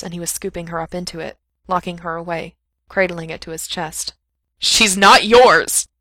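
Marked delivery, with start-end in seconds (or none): 4.6-5.9 loud, drawn-out furious shout; slow, very loud angry protest; spat out. A narrator's reading. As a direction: hurl it at them in outrage. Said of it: "he spat at Doctor Toro".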